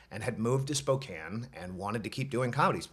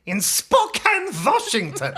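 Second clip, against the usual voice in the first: Doctor Strangelove voice; 11 semitones higher, 9 dB louder than usual. Acted. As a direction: drag out the place name like a mad scientist. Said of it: "it turned into Doctor Strangelove".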